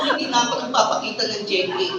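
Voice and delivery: usual speaking voice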